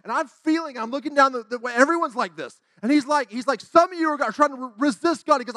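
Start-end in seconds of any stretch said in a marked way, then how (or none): 3.6-5.4 high, loud imitated preaching; revival preacher. Evangelist addressing a revival congregation, imitated forceful preaching. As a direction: proclaim it like a fired-up evangelist. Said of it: "this man was up there preaching".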